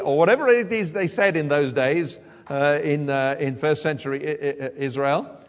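normal talking voice

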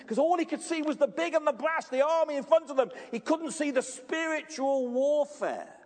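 high, loud sermon climax; urgent pulpit crescendo; impassioned preaching. Real, not acted. Preaches at full force.

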